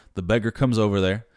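usual speaking voice

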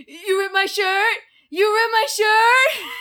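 high, very loud, slow screaming Cage; hammed-up Nick Cage meltdown; Nick Cage impression. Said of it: "Give me your Nick Cage impression".

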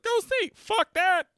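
very high, loud yelling; mock shout. Imitating her when he comes back, shouting. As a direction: yell it out like an outburst. Acted shouted movie quote.

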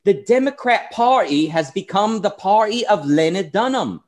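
a British commentator's accent, mildly imitated; Milo's British accent. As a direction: put on a posh-leaning British accent for the quote. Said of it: "the accent sounds more like Sargon than Milo".

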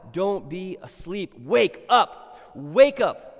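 loud high exhortation, slowing on don't; fiery preaching. Acted. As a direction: preach with force. Preaching at full force.

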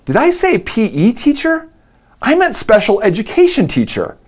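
put-on calmly fix a slip like a teenage girl; daughter correcting herself. Daughter corrects herself, tears stop.

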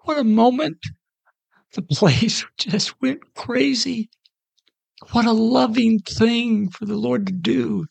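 fighting back tears in awe; moved to tears, words catching; choked up. Real, not acted. Preacher moved retelling the moment.

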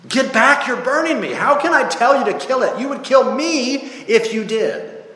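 very high, alarmed protest; panicked ghost. Acted. Ghost fends off the burning angel, high and panicked. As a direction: cry out as the heat gets too close.

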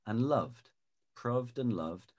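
loved and proved both with a short uh; proved and loved as a short-vowel rhyme. Shown as a demonstration. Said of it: "or a shorter vowel sound".

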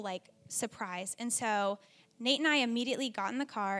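ordinary delivery

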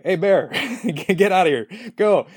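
high, half-laughing mock yell at a bear; squeaky, not-so-tough shooing of a bear; scaring off a bear. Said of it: "I don't have the most manly voice".

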